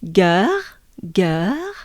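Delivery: "Gare" slow, ending in a breathed French R. Shown as a demonstration. Teacher demonstrating the French R, drawn out. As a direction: let "Gare" end on an exhaled R, rising. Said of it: "we have the French R".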